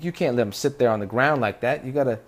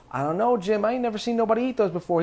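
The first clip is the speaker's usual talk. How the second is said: higher, fast, wary voice; doubtful tribesman; 8 semitones higher than usual. Retold or imitated. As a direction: sound unsure and quick. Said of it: "we're going".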